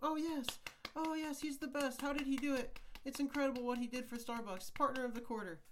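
high, loud gushing praise; hype crowd voice. Acted. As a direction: gush like an adoring fan. Fake crowd praising himself.

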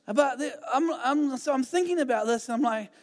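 ordinary delivery

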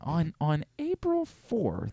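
soft, slow impression; Brian Windhorst. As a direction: lay out the timeline softly and slowly. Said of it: "Brian Windhorst right now".

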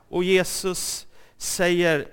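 ordinary talk, nothing marked

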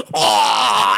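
loud, hammed-up gagging groan; exaggerated "ugh" of disgust; imitated disgusted reaction. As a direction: mimic being revolted by a smell. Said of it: "this is what my wife did".

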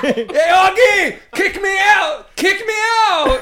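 very high, loud mock yelling; hammed-up high shout, words stretched; Isaiah. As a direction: holler it like a friend calling out in a dream. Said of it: "you were yelling at me".

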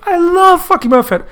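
high, gushing fanboy caricature; ecstatic superfan. Retold or imitated. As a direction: gush it in hammed-up adoration. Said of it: "He's like".

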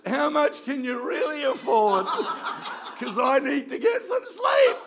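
loud, high, slow, laughing through the words; laughing shout. Retold or imitated. Pastor retelling the landlord's call, laughing shout. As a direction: shout the question while laughing. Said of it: "He goes".

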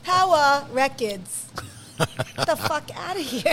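put-on "Tower Records" slow, in a Brooklyn accent. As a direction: drag out the embarrassing words. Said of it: "what I said in the video".

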